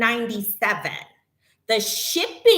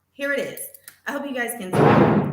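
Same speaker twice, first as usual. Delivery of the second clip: ordinary delivery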